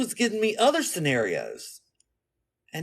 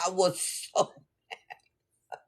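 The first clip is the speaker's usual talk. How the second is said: slow, softer, laughing "so mad"; laughing through it; 5 dB quieter, much slower than usual. Real, not acted. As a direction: giggle out the confession. Laughing at herself.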